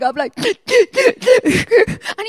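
high, slow, tearful voice breaking on the words; crying while talking. Real, not acted. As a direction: talk through real tears, laughing at yourself. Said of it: "and I'm crying".

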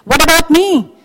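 high, loud, aggrieved protest; rising anger. Acted. Believer complaining to God about being passed over, angry. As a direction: plead your case indignantly at God. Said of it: "anger rising up".

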